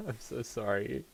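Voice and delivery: ordinary delivery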